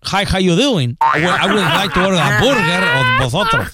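put-on English with a Spain Spanish accent, "vosotros" rising. [name] shows teacher his ruined English, in a Spain Spanish accent. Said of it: "el inglés lo hablo con acento de español".

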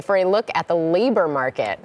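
normal talking voice

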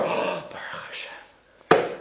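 gasp, then whisper it in amazement; acted hushed, grateful wonder; whispered thanks after a gasp; awed whisper. Awed man finds breads gone.

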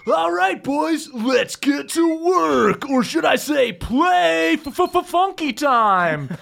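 high, slow shouted call; Funky calling his crocodile crew out of the jungle. Acted. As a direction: summon the crew across the jungle. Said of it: "calls out into the jungle and goes".

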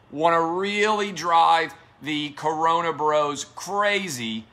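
normal talking voice

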